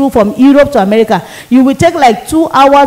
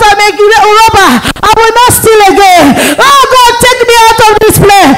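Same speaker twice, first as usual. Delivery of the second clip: desperate confessions; 7 semitones higher than usual. Retold or imitated. Sinners in hell confessing aloud, begging Jesus in anguish. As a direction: cry out confessing every sin. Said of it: "They are calling and say".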